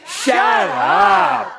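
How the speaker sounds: very high, loud, drawn-out yell; group shout. Pretend. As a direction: yell it all together on the count. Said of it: "Let's do that together just once".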